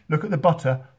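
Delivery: loud, delighted showing-off; excited. Real, not acted. Host showing viewers melting butter, loud and delighted. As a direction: relish the sight and call it out.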